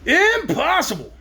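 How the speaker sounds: high, slow zebra protest. Acted. Zebra protesting to Dorothy, high and slow. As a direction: reject it with wounded pride. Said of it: "protested the zebra".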